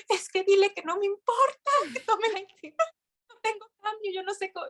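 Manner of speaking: high, tearful pleading; retold crying plea. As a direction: beg through tears, pitch cracking up. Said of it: "Y yo ya llorando".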